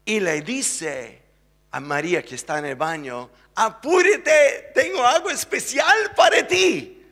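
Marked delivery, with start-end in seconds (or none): 3.5-7.0 high, loud, eager call through a door; excited shout. Acted. Juan calling to María in the bathroom, excited high shout. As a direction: call out to her, thrilled with the surprise.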